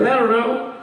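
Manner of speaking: put-on high, helpless drunk voice; drunk Rob. Drunk friend asked where he was, high helpless voice. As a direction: answer like a clueless overserved friend. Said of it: "he goes".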